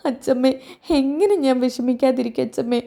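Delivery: a touch of sobbing grief; tearful. Acted. Grieving daughter after father's death. (കരച്ചിലോടെ പറയുന്നു)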